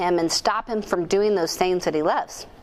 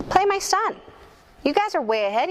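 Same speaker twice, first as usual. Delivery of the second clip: much higher, pleading outburst; exasperated plea; 10 semitones higher than usual. Retold or imitated. Mom's inner plea to coach at game, higher exasperated outburst. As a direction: mom begging the coach to play her son.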